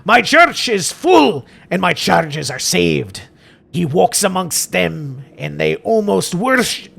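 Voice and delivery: put-on slow, declaimed Scottish accent, Rs rolled; hammed-up Scottish priest, drawn out; Father Jeremiah. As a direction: preach it grandly in put-on Scottish. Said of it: "Father Jeremiah's gotta be Scottish".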